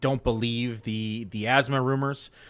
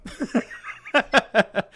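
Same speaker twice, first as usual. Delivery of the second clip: long high-pitched laugh; real laughter; 13 semitones higher, 4 dB louder than usual. Real, not acted. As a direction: crack up at your own joke. Laughing at own joke.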